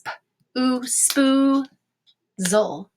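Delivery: "Sp-, ooh, spoo, zol" piece by piece; sounded out. Shown as a demonstration. Teacher modeling a two-syllable word, "spoo, zol" in pieces. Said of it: "let's go ahead and do this".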